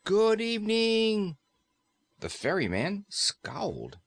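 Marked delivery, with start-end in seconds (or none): 0.1-1.4 slow, high, loud shout into his ear; yelled greeting. Acted. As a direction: yell it so a deaf man hears. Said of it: "she yelled as loudly as she could".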